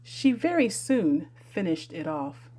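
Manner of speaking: usual speaking voice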